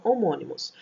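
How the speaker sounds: ordinary talk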